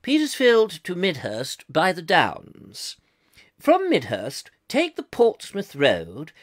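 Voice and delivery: put-on slow, measured old BBC announcer; plummy BBC accent, every word weighed. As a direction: read it in posh, deliberate BBC English. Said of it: "another bit of the B B C".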